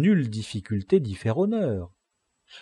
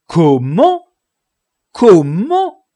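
loud, rising cry of disbelief; astonished cry; 8 semitones higher, 13 dB louder, much slower than usual. A narrator's reading. Sancho to Don Quichotte on learning who Dulcinée is, astonished cry. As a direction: cry it out high, the end shooting up. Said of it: "s'écria Sancho".

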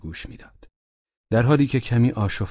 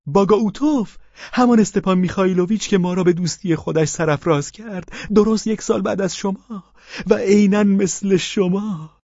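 higher, eager, fawning husband voice; Pavel Pavlovich voiced; 13 semitones higher, 4 dB louder than usual. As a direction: play the meek widower, pitched up. (صدای پاول پاولویچ، زیر و چاپلوس)

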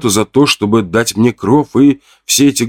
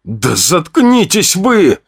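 hoarse wheeze; 9 semitones higher, much slower than usual. Acted. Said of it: "просипел я".

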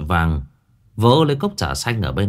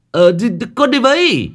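much higher, louder eager plea; eager tag-along; 13 semitones higher, 6 dB louder than usual. Acted. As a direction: jump at the chance to join. (giọng rất cao, háo hức đòi đi theo)

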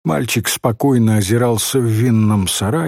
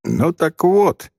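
put-on slow, higher-pitched boy's announcement; drawn-out, important preamble; solemn; 6 semitones higher, slower than usual. A narrator's reading. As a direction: announce it with ceremony. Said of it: "сказал мальчик торжественно".

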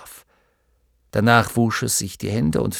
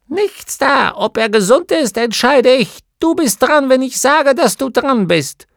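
put-on much higher, louder, sharply bossy little old woman; domineering gnome wife; 13 semitones higher, 8 dB louder than usual. A narrator's reading. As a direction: put him firmly in his place. (Gnomenweib weist ihren Mann zurecht, viel höher und lauter)

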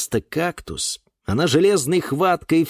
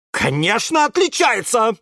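a higher, lightly acted angry bark; mild bark; 10 semitones higher, 3 dB louder than usual. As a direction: snap back at him. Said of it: "рявкнула тётя Глаша". (тётя Глаша рявкает на Юру, слегка сыгранно)

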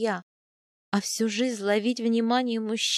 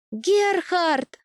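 a loud, much higher call of a name; shouting; 7 semitones higher, 5 dB louder than usual. A narrator's reading. The heroine spots Gerhard in the crowd and calls: loud shout. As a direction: shout across the crowd to a friend. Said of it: "закричала я".

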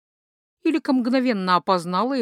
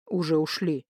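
low, dry reply; rather dry; 4 semitones lower, 3 dB quieter than usual. A narrator's reading. Sorcerer answering his wife, rather dry. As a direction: drop it drily, pitched down. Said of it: "довольно сухо обронил колдун".

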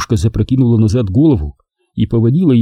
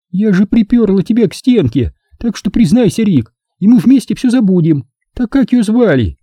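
high-pitched coaxing woman's voice; Nina, an insistent woman; 10 semitones higher than usual. A narrator's reading. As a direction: press him for a confession. (высокий женский голос, настойчиво)

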